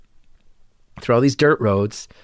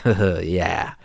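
menacing yeah; 6 semitones lower, 4 dB quieter than usual. Retold or imitated. Big guy's low menacing reply.

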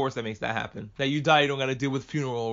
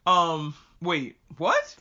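baffled; 3 dB louder, much slower than usual. Retold or imitated. Reacting to the taxi driver's remark, baffled. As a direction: voice jumps way up on "what".

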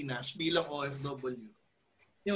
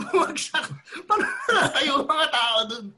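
laughing; 8 semitones higher, 6 dB louder than usual. Real, not acted. Laughing at the slip.

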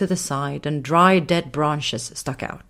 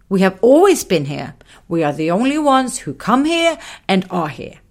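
higher, louder little man, stern and repetitive; insistent little man; 5 semitones higher, 6 dB louder than usual. Acted. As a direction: insist again and again. Muffin insists nobody comes.

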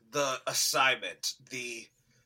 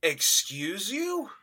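slow, higher, incredulous question; mock disbelief; 4 semitones higher, slower than usual. Podcaster reacts to talk of an Oscar, slow incredulous question. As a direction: draw it out, appalled. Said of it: "And I'm like".

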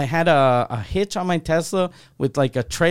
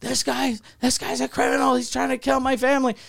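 pitched much higher, a mock alarmed yell; retold yelling; 8 semitones higher than usual. Tied-up man yelling to the village, mock high yell. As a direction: cry out the accusation, higher, half-acted. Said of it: "starts yelling".

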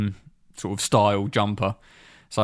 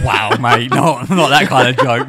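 loud laughing scold, "not that kind of joke"; mock telling-off; 8 dB louder than usual. Real, not acted. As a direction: call him out loudly, half joking. Mock telling-off after Gaza joke.

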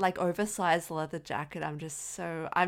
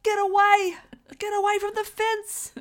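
put-on very high, loud accented yell; Sam Neill; 8 dB louder than usual. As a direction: shout a warning, mimicking his slipped accent. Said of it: "Sam Neill's accent came out".

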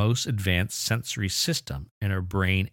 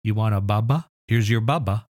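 put-on louder, sing-song baby-talk voice; mock baby talk; 2 semitones higher, 5 dB louder than usual. Teacher mocking parents who say bubba, louder. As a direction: ham up the silly parent. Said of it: "what do people say".

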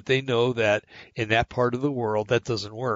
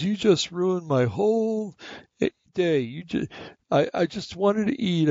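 pouting grandkid; 8 semitones higher than usual. Retold or imitated. Grandson sulks in the back seat at grandpa, high pouting whine. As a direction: mimic a kid on the verge of tears, pitched up. Pouting with his arms crossed, almost crying.

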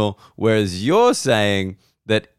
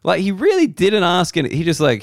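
ordinary delivery

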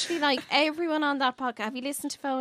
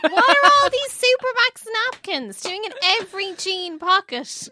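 exasperated shout; 8 semitones higher, 8 dB louder than usual. Retold or imitated. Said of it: "I'm like".

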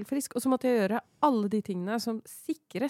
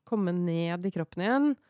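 ordinary delivery

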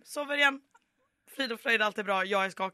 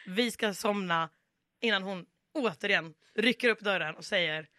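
usual speaking voice

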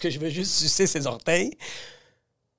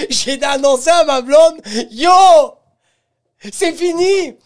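crié; 9 semitones higher, 9 dB louder than usual. Retold or imitated. Annonce criée dramatique.

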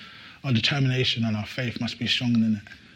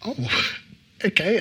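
laughing okay; 7 semitones higher than usual. Retold or imitated. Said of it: "I said".

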